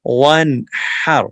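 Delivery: ون ہر said loud, ہ clear from the throat, نون not nasal. Shown as a demonstration. Teacher models ون ہر for students, loud. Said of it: "ہا کو clear نکالیں گے گلے سے".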